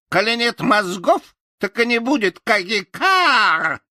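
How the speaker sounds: high taunting crow voice; mocking crow. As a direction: jeer and fly off laughing. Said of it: "захохотала она". (ворона насмешливо дразнит)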